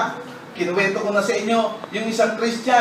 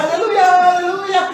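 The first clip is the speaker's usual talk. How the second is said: higher, louder hallelujahs building to a cry; hammed-up worshipper shouting hallelujah; ecstatic praise shouts; 10 semitones higher, 5 dB louder than usual. Still falling, shouting praise.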